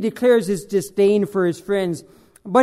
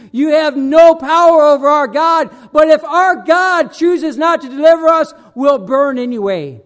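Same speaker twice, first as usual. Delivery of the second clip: defiant declaration; 5 semitones higher, 7 dB louder than usual. Acted. Defiant quote to the king.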